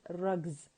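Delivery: "rugs" ending in a buzzing z. Shown as a demonstration. Teacher modelling the plural, "rugs" with z. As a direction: say "rugs" with a voiced z. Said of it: "you have to pronounce it as".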